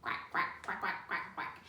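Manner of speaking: soft breathy quacks; duck quack. Pretend. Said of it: "Do you know what a duck says".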